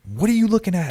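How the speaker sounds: high-pitched drill-instructor challenge; imitated yelling. Imitated instructor confronting a new recruit, yelling. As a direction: bark it into a recruit's face. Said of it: "yelling at me".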